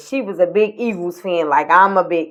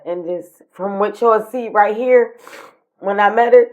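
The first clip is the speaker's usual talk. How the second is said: ordinary talk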